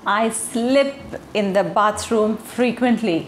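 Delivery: "slip" with a short clipped i, said slowly; slow careful model of "slip"; correct short i in slip. Shown as a demonstration. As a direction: keep "slip" short, not "sleep". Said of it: "मेरा कहने का मतलब था".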